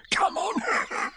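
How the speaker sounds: very high, eager creature voice; friendly smile. A narrator's reading. Tiger urging his friends along, high and eager. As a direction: burst out cheerily. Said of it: "with a large and friendly smile".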